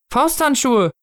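put-on high child's voice; small child. Child showing mittens to the Snow Father, high child voice. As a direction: answer in a high little voice.

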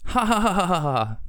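high acted ha-ha laugh, falling in pitch; hammed-up laughter. A narrator's reading. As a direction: fake a burst of laughter. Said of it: "Sie platzten fast vor Lachen". (gespieltes, hohes Auflachen)

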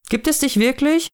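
put-on high, wondering child voice; small child. Child questioning the Snow Father, high wondering voice.

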